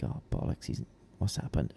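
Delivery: hushed panicked whisper. Retold or imitated. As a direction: breathe it out in alarm. Retold panic at silent door.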